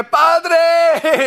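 high excited cry of "Padre"; dogs' Spanish greeting. Retold or imitated. Pastor voicing the chihuahuas calling him in Spanish, high and excited. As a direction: chirp it like a delighted little dog. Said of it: "they go".

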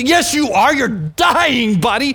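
loud, high, insistent comeback; acted-out exasperated shout; urgent retort. Acted urgent retort to heat victim.

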